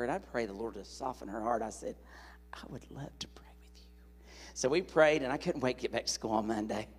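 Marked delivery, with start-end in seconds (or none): 2.5-4.3 soft, slow whisper. Retold or imitated. Agreeing to pray with the grandfather, slow whisper. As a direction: say it gently, almost under breath.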